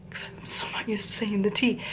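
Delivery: hushed confiding whisper; whispered aside. Real, not acted. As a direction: lean in and whisper it.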